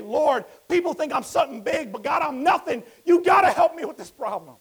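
loud, high, desperate shout to God; shouted prayer. Acted. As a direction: cry out on your knees in desperation. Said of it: "fall down at Jesus' feet and say".

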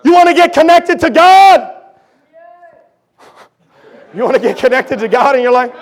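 loud, high revival preaching; preaching at full force. Acted. Preacher rallying the church, full-force preaching. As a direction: drive it home to the congregation.

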